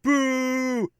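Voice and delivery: high, long drawn-out boos; crowd booing. Acted. Crowd jeers the villain after her win, loud high booing. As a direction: boo like a hostile wrestling crowd. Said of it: "the crowd is booing. They're like".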